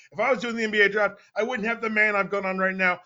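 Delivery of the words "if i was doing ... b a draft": usual speaking voice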